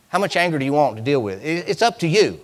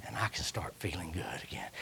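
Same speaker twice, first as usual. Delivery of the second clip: low whisper; whispered hope; 11 semitones lower, 23 dB quieter than usual. Acted. Preacher voicing the sick, whispered hope. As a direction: breathe the hope out.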